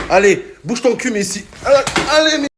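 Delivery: loud cheering him on; shouting encouragement. Pretend. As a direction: yell at him to move. Urging Messi on.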